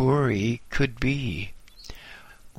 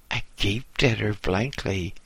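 ordinary delivery

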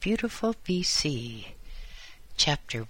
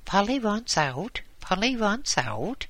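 high squawking parrot voice; raucous parrot squawk; 7 semitones higher than usual. Acted. Narrator voicing the caged parrot, high squawk. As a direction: screech it like a parrot wanting out. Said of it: "there was a raucous squawk".

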